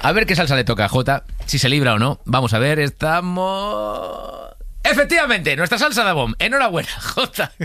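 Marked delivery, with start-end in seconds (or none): 2.8-7.5 high, excited game-show reveal. Acted. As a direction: announce the result with glee. Host reveals result. (anuncio entusiasmado de concurso)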